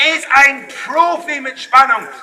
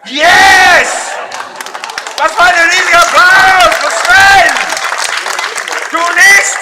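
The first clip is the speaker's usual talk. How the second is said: loud, high showman's shout; calling for applause; 6 semitones higher, 5 dB louder than usual. Real, not acted. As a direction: rouse the crowd at full voice. Demands applause for volunteer.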